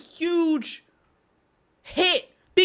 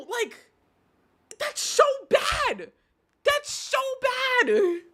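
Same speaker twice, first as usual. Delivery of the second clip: furious rant; 11 semitones higher than usual. Real, not acted. Streamer rants to chat about the unpaid charity money, furious shouting. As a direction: let the outrage boil over. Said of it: "But I'm just so angry".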